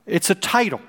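ordinary talk, nothing marked

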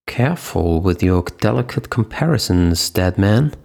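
plain reading voice

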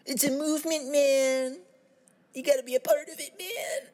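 high, strained stoner hippie; put-on high hippie drawl. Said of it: "stereotypical hippie voice".

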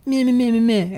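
high, bratty meh-meh; pouting taunt. Retold or imitated. Wife taunting her husband like a ten-year-old bully, in a high bratty voice. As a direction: stick out the lip and taunt like a kid.

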